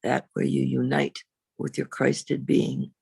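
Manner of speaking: ordinary talk, nothing marked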